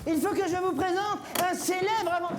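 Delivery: high, ceremonious presenter voice; high-pitched herald; eager courtier. Acted. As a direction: announce it to the king in a high put-on voice. Plays courtier presenting an inventor.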